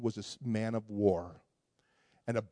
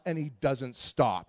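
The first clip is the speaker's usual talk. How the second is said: usual speaking voice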